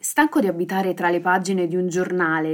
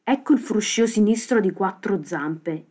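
usual speaking voice

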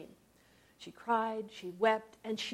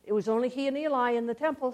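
usual speaking voice

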